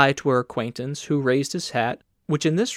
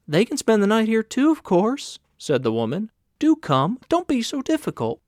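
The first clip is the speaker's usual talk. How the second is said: a hint of a higher, coaxing woman's voice; lightly voiced woman; 7 semitones higher than usual. A narrator's reading. Landlady urging Carl to stay, higher and coaxing. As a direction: coax him gently, a bit higher.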